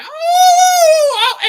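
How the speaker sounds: long, high loud wail; imitated howl. Host mimics the howling woman for viewers, mock howl. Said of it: "she was like".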